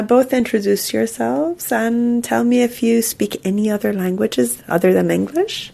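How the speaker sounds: ordinary talk, nothing marked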